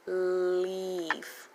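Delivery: "Leaf" with the EE held long; long E sound. Shown as a demonstration. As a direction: stretch the vowel in "Leaf" slowly. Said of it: "leaf is the long E".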